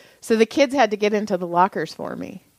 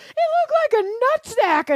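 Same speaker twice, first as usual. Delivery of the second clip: put-on kids' voice. Kids quoted mocking tree.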